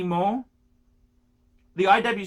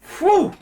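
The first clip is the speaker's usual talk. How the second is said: whistle of disbelief; 11 semitones higher, 7 dB louder than usual. Real, not acted. Reacting to retail price.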